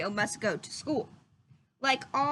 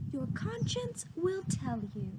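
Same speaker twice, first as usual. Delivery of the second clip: higher, soft fairy voice; 5 semitones higher, 5 dB quieter than usual. A narrator's reading. Blue fairy instructing Pinocchio, higher soft voice. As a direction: guide him gently as the fairy. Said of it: "said the blue fairy".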